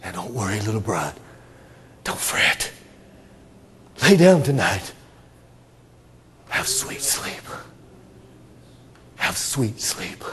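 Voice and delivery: whispered bedtime comfort; lullaby whisper. Acted. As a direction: lull them to sleep. Lulling the bride to sleep.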